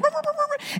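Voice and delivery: very high, quick doggy noises; high-pitched mock dog whimper; imitated dog yelps. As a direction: mimic a little dog scampering off.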